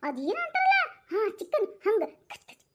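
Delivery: normal talking voice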